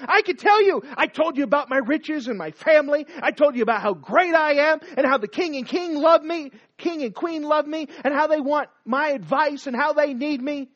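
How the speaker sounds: loud and high, still ranting; bitter raging complaint; angry rant. Acted. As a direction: keep the fury going. Haman's rant continues.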